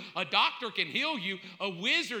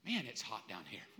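much lower, softer comic grumble; low mock aside; 13 semitones lower, 12 dB quieter than usual. Preacher voicing a lost soul below, low mock aside. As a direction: drop down to an understated, quiet complaint. Acts someone who landed in hell.

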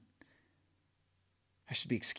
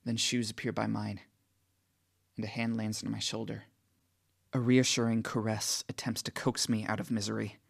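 usual speaking voice

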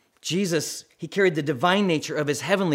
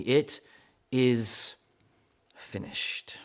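fading from low voice to a breathless whisper; last words squeezed out in agony; dying declaration; 3 semitones lower, 5 dB quieter, much slower than usual. Retold or imitated. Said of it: "he declares through the agony".

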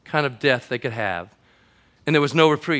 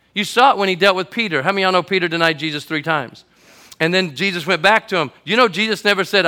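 rising, louder preacher build; preaching; 4 semitones higher, 7 dB louder than usual. Acted. Pastor building up the congregation, full preaching voice. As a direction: lift the church with it.

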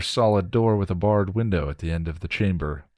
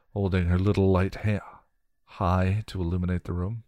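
plain reading voice

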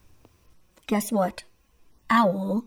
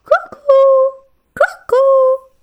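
very high, loud cuckoo cry; a cuckoo calling through the forest; 8 dB louder, slower than usual. Acted. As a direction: call out like a cuckoo clock bird. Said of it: "The cuckoo called".

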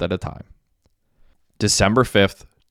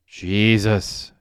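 exclaiming. A narrator's reading. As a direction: stretch the word in a mild exclamation. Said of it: "exclaimed Derek".

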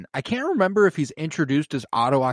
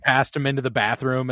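usual speaking voice

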